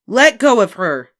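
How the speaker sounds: high, loud, slow angry command. A narrator's reading. Big sister yells at the boy, angry. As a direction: defend her sister angrily. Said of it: "said Beezus angrily".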